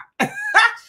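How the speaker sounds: short, very high, loud laugh; a quick high laugh; burst of laughter. Real, not acted. Laughs after reading comment.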